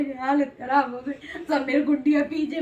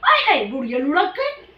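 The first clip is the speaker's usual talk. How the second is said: mock exclamation; 3 semitones higher, 5 dB louder than usual. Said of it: "तो मैंने बोला".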